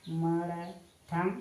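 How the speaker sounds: "किमर्थम" very slow, syllables drawn apart; slow dictation. Shown as a demonstration. Teacher writing the Sanskrit question word for students, slow dictation. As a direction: stretch out each syllable of the word.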